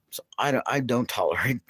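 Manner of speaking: ordinary talk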